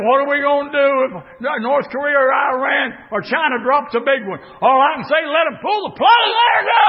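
shouted, high preaching cadence; preaching at full force. Acted. Preacher shouting to the congregation, full force. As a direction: preach it out to the church.

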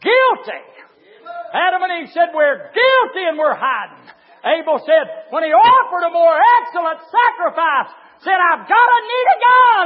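loud, high-pitched hellfire sermon; thundering pulpit shout; full-force preaching. Acted. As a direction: preach it at the top of your voice.

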